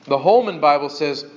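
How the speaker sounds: ordinary delivery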